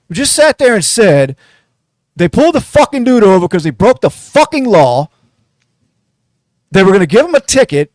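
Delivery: loud, heated rant at the commenters; angry rant. Real, not acted. Podcaster fed up with commenters, yelling angrily. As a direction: vent your fury at the idiots in the comments.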